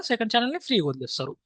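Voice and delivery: ordinary talk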